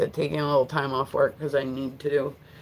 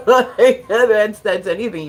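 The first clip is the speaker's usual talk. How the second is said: laughing; 4 semitones higher, 8 dB louder, slower than usual. Real, not acted. Laughing at own remark.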